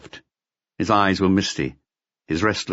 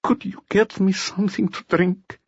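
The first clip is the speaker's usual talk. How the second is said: wheedling; 12 semitones higher than usual. Acted. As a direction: ask sweetly for a drink. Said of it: "Then he wheedled".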